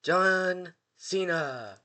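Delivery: slow, drawn-out high ring announcement; announcing John Cena. Retold or imitated. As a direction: stretch the name out like an entrance call. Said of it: "Danhausen goes".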